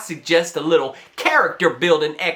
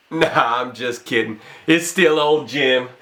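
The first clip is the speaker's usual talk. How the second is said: usual speaking voice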